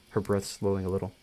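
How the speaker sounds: usual speaking voice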